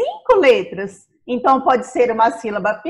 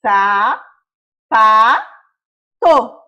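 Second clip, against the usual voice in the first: "Sa, pa, to" evenly spaced, louder; slow syllables; 3 semitones lower, 4 dB louder, much slower than usual. Shown as a demonstration. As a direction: count the syllables out. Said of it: "Mais uma vez".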